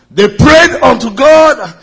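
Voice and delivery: rising fervent sermon voice; preaching. Acted. Preaching at full force.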